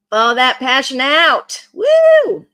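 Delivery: a loud, high whoop of joy; excited cheer. Real, not acted. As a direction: let the passion burst out with a big whoop. Cheering the passion card out.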